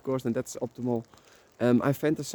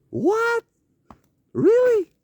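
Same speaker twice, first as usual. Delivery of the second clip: squeaky high, disbelieving "What? Really?"; astonished what; 7 dB louder, much slower than usual. Retold or imitated. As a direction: shoot way up in pure disbelief. Hearing he has followers, astonished.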